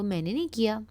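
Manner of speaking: usual speaking voice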